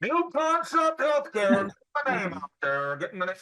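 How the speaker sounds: high, slow street yell fading out; loud sharp voice. Retold or imitated. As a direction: shout the company name at shoppers. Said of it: "Why are you yelling at me?".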